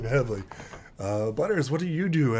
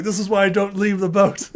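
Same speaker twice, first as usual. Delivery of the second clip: louder, higher fed-up complaint; exasperated grumble; 7 semitones higher, 6 dB louder than usual. Acted. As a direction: grumble like a sailor hauled ashore. Silver voiced, exasperated.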